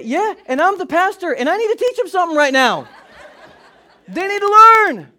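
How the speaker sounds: hammed-up pastor claiming the right; self-righteous retort. Pastor answering his wife, loud mock-righteous reply. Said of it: "To which I say".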